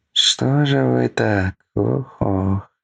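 slow, drawn-out sigh of pity; lamenting. A narrator's reading. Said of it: "посетовал пастух".

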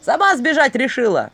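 loud, high angry shouts; shouting. Acted. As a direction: yell after a runaway. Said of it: "закричали".

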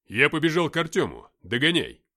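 loud, urgent call to a comrade. Acted. Man running off to join Артём, calling out loudly. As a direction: shout it back as you run off.

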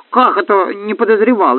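high-pitched indignant protest; offended. A narrator's reading. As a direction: pipe up like an affronted gnome. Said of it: "обиделся гном Юхан".

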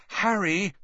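high, sharp warning; warningly. A narrator's reading. Hermione warning Harry not to draw, high sharp call. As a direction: stop him with a warning. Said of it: "Hermione said warningly".

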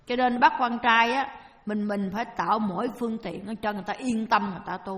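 ordinary talk, nothing marked

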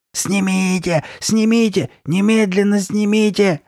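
high, desperate shouted demand; hoarse screaming. A narrator's reading. Girl with her new hoarse voice, screaming demand. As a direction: scream for it to come off. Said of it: "закричала Карли Бет своим новым сиплым голосом".

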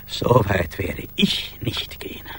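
slow, whispered protest; hushed denial. Acted. Accused of siding with the rhinos, whispering a slow denial. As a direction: whisper it, backing away from the charge.

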